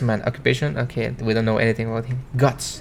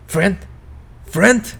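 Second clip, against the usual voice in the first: incredulous; 7 semitones higher than usual. Acted. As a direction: throw the word back at him, disbelieving and rising. Incredulous repetition of friend.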